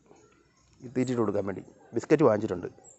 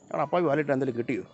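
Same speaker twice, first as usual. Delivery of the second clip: usual speaking voice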